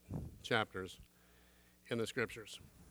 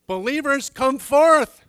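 higher, very loud rallying call; triumphant shout; 12 semitones higher, 17 dB louder, slower than usual. Acted. Preacher calling believers at the rapture, triumphant shout. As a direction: shout them up out of the graves.

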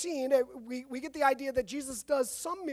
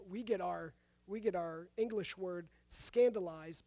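usual speaking voice

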